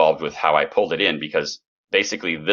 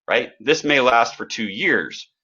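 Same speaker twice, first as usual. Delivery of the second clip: ordinary delivery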